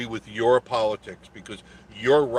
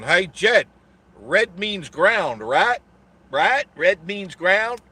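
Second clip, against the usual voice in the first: higher, louder and slowed, a hammed-up dumb worker; sarcastic impression of a lineman asking which wire is ground; mock clueless lineman; 8 semitones higher, 8 dB louder, much slower than usual. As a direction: play the lineman who gets the wires wrong, hammed up. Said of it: "just kidding, guys".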